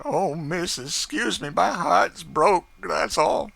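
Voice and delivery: high, slow, breaking with grief; a heartbroken cry on her mistress's shoulder; sobbing. Acted. Chloe sobs on her mistress's shoulder, high broken crying. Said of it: "sobbed out".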